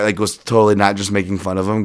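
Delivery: normal talking voice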